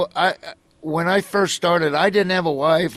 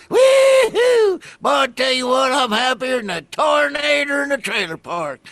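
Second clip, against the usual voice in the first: loud, much higher hollering hillbilly tow truck; Mater voice; 11 semitones higher, 6 dB louder than usual. Retold or imitated. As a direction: holler it out like the cartoon tow truck greeting kids. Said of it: "That's Mater from Cars".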